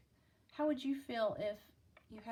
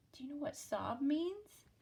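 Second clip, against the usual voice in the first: plain reading voice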